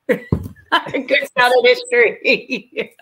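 real laughter running through the words; laughing recollection. Real, not acted. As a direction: stretch the words out while laughing. Laughing at own favourite memory.

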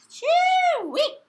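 high, loud disgusted squeal; mock disgust at smelly socks; pee-yew squeal. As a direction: squeal at the stink.

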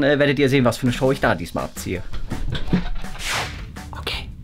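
ordinary delivery

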